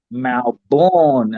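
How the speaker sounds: "Melbourne" said as MAL-born, a long open first vowel and a full "born". Retold or imitated. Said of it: "when [name] say it, he says".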